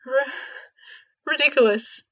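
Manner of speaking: strangled, stammered spell; choked out. A narrator's reading. George trying the spell again, choked out. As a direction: choke the word out. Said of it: "he choked out".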